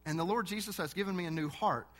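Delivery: normal talking voice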